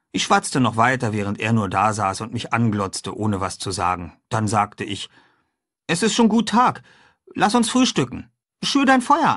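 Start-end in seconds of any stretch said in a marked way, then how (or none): 5.9-9.4 put-on high, lively boyish voice; a cheerful boy proposing breakfast; boy talking brightly to his friend; young boy Huck. A narrator's reading. Huck's voice in dialogue.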